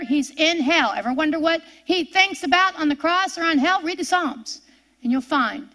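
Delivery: usual speaking voice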